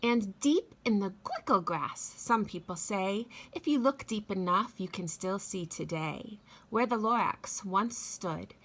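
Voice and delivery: lively read-aloud with big swoops in pitch; storybook reading. A narrator's reading. Teacher reading The Lorax to children, expressive read-aloud voice. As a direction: read it like story time for little kids.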